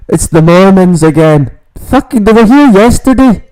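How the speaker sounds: high, exasperated groan at the door; mock exasperation. Groaning to the household that the Mormons are back, mock exasperated. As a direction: whine about the visitors being back.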